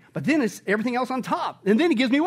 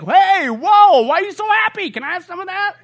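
much higher, louder, astonished; amazed onlooker; 12 semitones higher, 10 dB louder than usual. Pretend. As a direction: gush like a stranger amazed by their joy.

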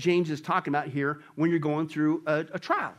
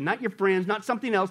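usual speaking voice

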